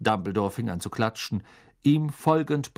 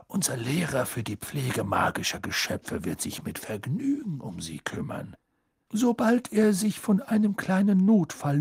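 put-on polite old headmaster; 6 semitones higher than usual. A narrator's reading. Dumbledore explains.

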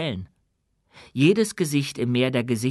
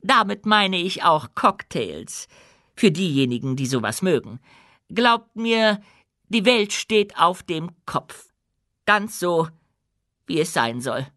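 higher, louder regal queen voice; proud, firm queen addressing her subjects; dwarf queen, commanding; 9 semitones higher, 3 dB louder than usual. Acted. Queen's closing words.

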